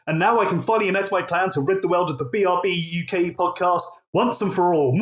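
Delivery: normal talking voice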